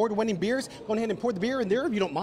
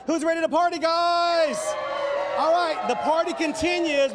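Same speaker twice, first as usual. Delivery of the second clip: rowdy party animal; 7 semitones higher than usual. Real, not acted. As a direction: pump up the crowd, shouting. Hyping crowd at brewery.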